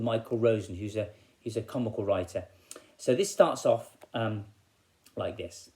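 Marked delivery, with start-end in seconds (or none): none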